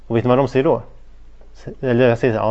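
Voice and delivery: usual speaking voice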